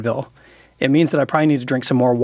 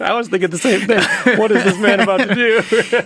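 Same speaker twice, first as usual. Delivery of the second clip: higher, laughing through the words; 7 semitones higher than usual. Real, not acted. Pastor laughing with friend over bold church move, laughing. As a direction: chuckle while recalling the moment.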